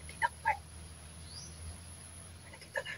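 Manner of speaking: barely audible hushed whisper. Real, not acted. Trekker to companion on spotting something, whispering. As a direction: whisper it so nobody out there hears. Hushed talk while spying a house.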